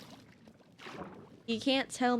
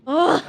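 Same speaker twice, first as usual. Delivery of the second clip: loud, raised groan; frustrated groan; 4 semitones higher, 12 dB louder than usual. Real, not acted. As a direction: groan out in exasperation. Knocked back in game.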